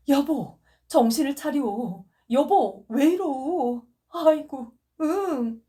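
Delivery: high, loud tearful plea; tearful pleading. Acted. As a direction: beg your wife to come to her senses. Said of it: "소리는 눈물에 젖었다". (높고 크게 눈물 젖은 애원)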